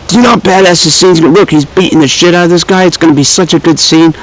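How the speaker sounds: a rush of fanboy excitement; hyped-up retelling. Retold or imitated. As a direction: sell it like the best scene ever. Quoting his own hype about the movie.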